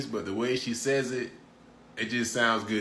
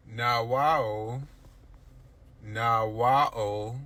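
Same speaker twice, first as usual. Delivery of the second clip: mock surprise; much slower than usual. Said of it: "Pidgin expression demo".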